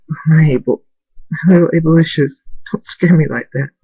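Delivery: cling and speak through happy tears; tearful relief. A narrator's reading. Father hugging found Abel, tearful relief. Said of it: "tears go down both our faces".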